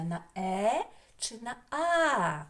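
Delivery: ordinary talk, nothing marked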